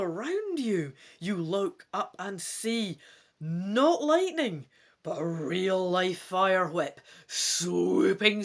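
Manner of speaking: slow, loud storyteller build-up; dramatic story narration. A narrator's reading. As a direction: read it like a thrilling adventure. Dramatic gamebook reading.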